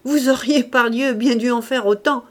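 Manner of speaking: put-on old man joking heartily; hearty jovial old man. A narrator's reading. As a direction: boom it out with rough good humour. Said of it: "avec un gros rire".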